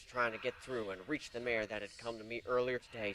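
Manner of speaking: ordinary delivery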